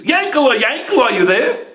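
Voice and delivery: high-pitched call to a friend. A narrator's reading. Merchant trudging through snow calls his friend, calling out high.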